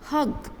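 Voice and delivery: "ഹഗ്" high and clipped, a short uh, not haag; hug with short uh. Shown as a demonstration. Teacher correcting the class: "ഹഗ്" clipped, short uh. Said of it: "ഹാഗ് എന്ന് നമ്മൾ പറയില്ല".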